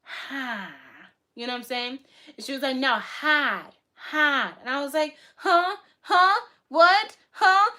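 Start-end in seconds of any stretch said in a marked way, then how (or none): 0.0-0.7 mocking Boston "hahd" like a Minecraft villager; drawn-out R-less Boston "hah"; Boston accent. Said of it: "sound like a Minecraft villager". 2.8-4.5 insistent imitated Boston "hahd", higher and louder; Boston accent. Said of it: "what she was saying was hard". 5.4-7.7 loud, high, acted-out confusion; baffled. Acting out her baffled replies.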